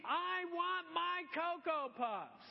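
put-on child carried out of the store, wailing; screaming child. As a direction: wail it as if carried out kicking. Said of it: "screaming as they go out".

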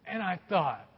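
ordinary talk

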